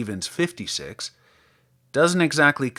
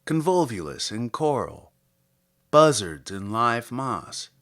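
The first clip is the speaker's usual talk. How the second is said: measured, deliberate poem reading with long pauses; poetry reading; 4 semitones higher, 4 dB louder than usual. Acted. Podcast host reading a Wallace Stevens poem to listeners, measured poetry reading. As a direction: read it slowly, savouring each image.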